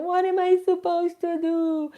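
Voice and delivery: mock her own overwhelmed whining; whiny self-parody. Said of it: "I'm making fun of myself".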